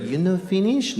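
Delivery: high imitated Japanese accent; quick replay of the waitress's accent. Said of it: "It's like".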